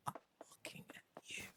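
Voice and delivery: very soft, pointed whisper. Pretend. Player calling out Stardew Valley, mock-menacing whisper.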